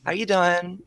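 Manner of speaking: put-on high bubbly girl voice greeting viewers; chirpy feminine vlogger hello; girly vlogger voice. Shown as a demonstration. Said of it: "talk like a girl".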